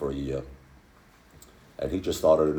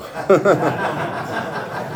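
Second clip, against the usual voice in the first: laugh; 12 semitones higher, 4 dB louder than usual. Real, not acted. Laugh at own story.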